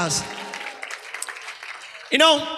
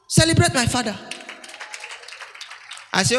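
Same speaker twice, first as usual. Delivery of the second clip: ordinary talk, nothing marked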